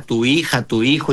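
ordinary talk, nothing marked

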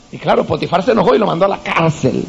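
ordinary talk

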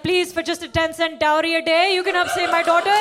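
loud, pleading charity commercial. Pretend. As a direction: plead like a rescue-animal ad. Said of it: "like an A S P C A commercial".

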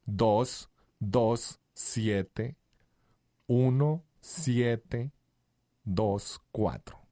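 slow digits with long pauses; dictating slowly. Real, not acted. Host giving listeners the phone number to note, slow dictation. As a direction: read it out for someone writing it down.

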